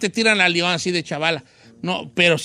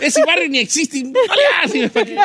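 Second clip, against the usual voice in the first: high laughing voice; laughing hard; 8 semitones higher than usual. Real, not acted. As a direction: talk through laughter. Laughing at the fake barrio.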